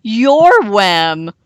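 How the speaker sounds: loud, slow shout of relief; relieved shout. A narrator's reading. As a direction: call out to friends in relief. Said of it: "Goose shouted with relief".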